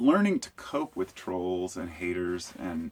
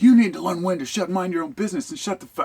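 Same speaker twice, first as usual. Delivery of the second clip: yelled high and loud, retelling the angry driver; 9 semitones higher, 7 dB louder than usual. Retold or imitated. Retelling the driver yelling at him in the lot, loud and angry. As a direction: bark it out at the top of the voice.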